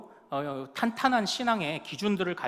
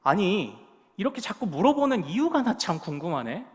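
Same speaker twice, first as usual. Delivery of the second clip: acted higher, louder challenge; indignant retort; 3 semitones higher, 5 dB louder than usual. Blind man challenging the Pharisees' questions, indignant. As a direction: throw the question back at them, exasperated. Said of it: "이렇게 호통을 치죠".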